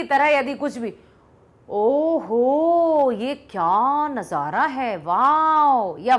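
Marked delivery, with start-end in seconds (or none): 1.7-6.0 very drawn-out, slow exclamations of amazement; demonstrated wonder. Shown as a demonstration. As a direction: act out marvelling, stretching "ओहो" and "वाओ". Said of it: "भावनाओं को व्यक्त करने में".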